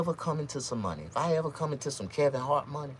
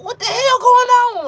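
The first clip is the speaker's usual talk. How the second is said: high, loud, drawn-out yell of alarm; shocked yell; 16 dB louder than usual. Retold or imitated. Yelling at the altar in shock. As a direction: scream it like you can't believe it. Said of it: "I'm like".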